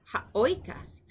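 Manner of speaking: "ha oikas" with OI accented; ha OI-kas, second word stressed at its start; stress on the diphthong. Shown as a demonstration. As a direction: lean on the "oi" of "oikas". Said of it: "the accent is on the diphthong".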